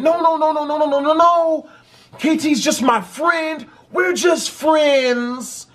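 high, imitated girl's voice pleading; mock a girlfriend's frantic protest, stretching the final words; high-pitched, hammed-up denial; panicked girlfriend. Girl runs in, protesting to boyfriend.

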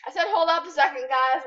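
loud, fast, firm repeat; insistent. Real, not acted. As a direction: insist they wait.

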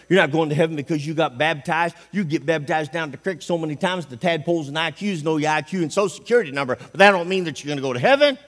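normal talking voice

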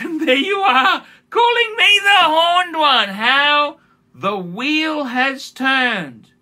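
high, loud, very slow hammed-up doom voice; laughing theatrical woe; mock dramatic lament. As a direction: play the wronged villain grandly.

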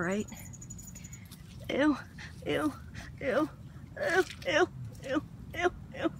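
ordinary delivery

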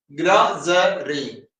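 ഗ്രോസറി split slowly into three syllables. Shown as a demonstration. Teacher showing the syllables of ഗ്രോസറി, syllable by syllable. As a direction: break ഗ്രോസറി into separate beats, gro-se-ry. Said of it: "syllable ആണല്ലോ".

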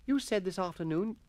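schoolboy voice. Acted. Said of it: "Derbyshire reminded him".

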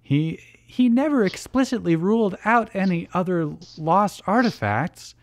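lightly acted worried friend; concerned. A narrator's reading. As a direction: offer it reluctantly, slowing at the end. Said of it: "Taya looks concerned".

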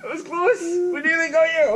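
very high, squeaky excitement; gleeful near miss. Real, not acted. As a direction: squeal it, recovering from laughter. Excited after near spit-take.

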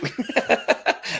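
high giggling laugh. Real, not acted. Told by a viewer of looking thinner, high laugh. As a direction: laugh at the compliment.

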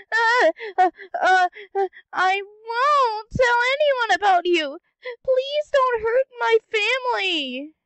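high, loud stammering begging; whimpering plea; terrified pleading. Acted. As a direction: beg for your family's lives. Frightened plea to villain.

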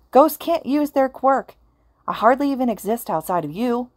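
snapping. A narrator's reading. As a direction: snap it at him in frustration. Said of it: "Oboro snapped".

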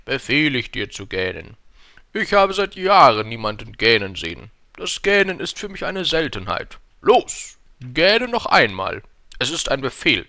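loud, pompous king voice; befehlender König. A narrator's reading. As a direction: command like a self-important monarch. King orders the prince to yawn. (lauter, herrischer König)

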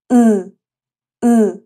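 ا said through the nose as the Turkish letter ı; nasal Turkish letter. Shown as a demonstration. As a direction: voice ا nasally, loud and clear. (ا را از بینی تلفظ کن)

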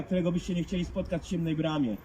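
usual speaking voice

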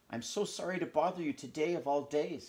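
a touch apologetic, voice lifted; sorry to intrude on Christmas morning. Acted. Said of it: "said Missus Pickering apologetically".

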